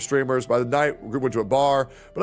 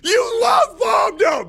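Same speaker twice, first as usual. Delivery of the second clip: high, laughing accusatory shout; 5 dB louder, much slower than usual. Pretend. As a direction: yell it through laughter. Mock outrage shout at chat.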